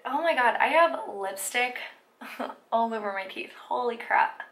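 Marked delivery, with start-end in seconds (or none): none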